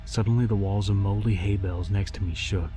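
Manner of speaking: ordinary delivery, nothing marked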